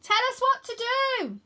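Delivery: put-on boy on dragon shouts to friend below; calling out. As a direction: call across to her urgently.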